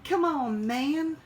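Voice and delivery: loud, high hammed-up come on man; exaggerated Biden catchphrase; Joe Biden. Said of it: "I sound like Joe Biden".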